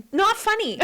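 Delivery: high, indignant sleep-talker imitation; comically offended, high voice; mock-serious scolding. Imitates husband scolding her laughter.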